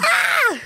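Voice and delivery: a real burst of excited screaming when asked. Real, not acted.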